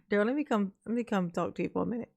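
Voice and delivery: ordinary talk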